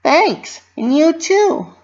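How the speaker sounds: high, bouncy cartoon pony voice; Pinkie Pie voice. A narrator's reading. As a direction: voice a cheerful pony fretting to herself.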